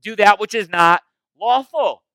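slow, loud, high indignant accusation; mock-outraged religious scolds; scandalized Pharisees. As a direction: drag it out, outraged and pointing. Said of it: "they say".

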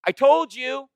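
high, loud told-you-so gloat; shrill triumphant accusation; gloating Pharisees. Retold or imitated. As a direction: crow it like a tattletale catching someone. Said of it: "It's, it's like this".

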